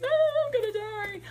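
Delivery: high-pitched hammed-up wail of dread; mock panic. Facing the ranting woman, mock-panicked wail. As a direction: squeal it in comic terror.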